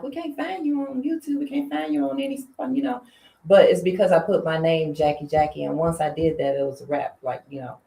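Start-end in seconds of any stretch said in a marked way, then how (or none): 0.0-3.0 higher voice imitating fans; lightly voiced fans, pitched up and softer; mock fan voice, high and hushed; fans asking. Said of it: "They were like".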